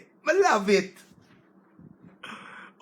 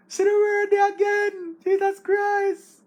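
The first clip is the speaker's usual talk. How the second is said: thrilled exclamation; 12 semitones higher, 4 dB louder than usual. Real, not acted. Thrilled by the new word.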